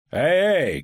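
high call to servants; shouted. A narrator's reading. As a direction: call out sharply to summon servants. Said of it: "крикнул он слугам".

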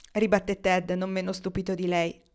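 ordinary delivery, nothing marked

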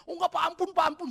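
high, loud mock pleading; memohon ampun. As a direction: imitate a caught thief begging for mercy. Menirukan maling memohon ampun. (memelas minta ampun, suara tinggi)

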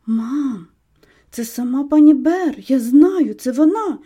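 ordinary delivery

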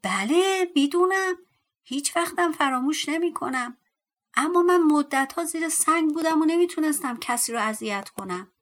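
small dwarf voice, loud and high; high squeaky shriek. A narrator's reading. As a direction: squeal it like a tiny storybook creature. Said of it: "با صدای ریزی جیغ کشید".

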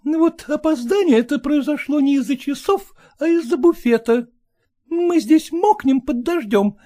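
high, sharp nagging wife; scolding wife. Acted. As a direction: lay into him, high and cutting.

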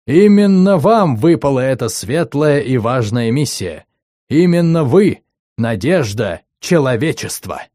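громкий призыв диктора с пафосом; воодушеви класс, как диктор; торжественно и громко. Acted. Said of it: "стал торжественным и волнующим". (торжественный призыв диктора)

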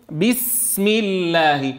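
بسم slow with the sin briefly held before the mim; held sukun. Shown as a demonstration. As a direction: brake on the resting sin of بسم. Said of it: "انظر هذا الاحتباس".

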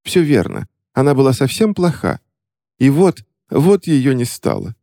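high, sorrowful put-on voice, slowing; mock grieving lady. A narrator's reading. Sly witch feigning grief to the clerk. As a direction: play the grieving niece. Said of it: "хитрая ведьма продолжила".

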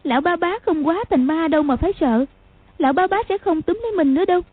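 put-on a girl reassuring herself; girl talking herself out of fear; telling herself. A narrator's reading. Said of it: "tự nhủ".

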